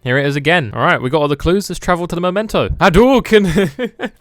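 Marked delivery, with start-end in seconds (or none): 2.7-4.1 stretched, very loud, high battle cry; fighting game shout. Pretend. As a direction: yell it like a special move.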